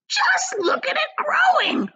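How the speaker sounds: high, slow, laughing cry; excited cry. Acted. Aunt Spiker delighting in the peach, high and laughing. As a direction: crow with delight. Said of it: "Aunt Spiker cried".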